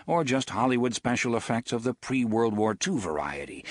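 ordinary delivery, nothing marked

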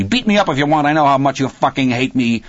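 high-pitched angry dare; defiant yell. A narrator's reading. Crying Dennis says his father hates him, defiant yell. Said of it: "he yelled at Hal".

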